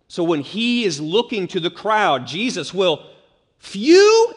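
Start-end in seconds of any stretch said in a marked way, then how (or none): 3.6-4.3 "Few" hit far higher and louder; preacher leaning on "Few" with a big lift; stress on few. Shown as a demonstration. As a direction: punch the word "Few" hard. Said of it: "emphasis on the few".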